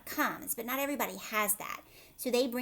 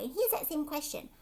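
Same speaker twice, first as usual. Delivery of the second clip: plain reading voice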